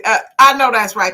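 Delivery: usual speaking voice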